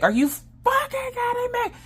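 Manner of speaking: very high, squeaky outrage; stretched falsetto mock fury; mock livid. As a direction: imitate exasperated disbelief in a strained high squeak. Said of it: "He is livid".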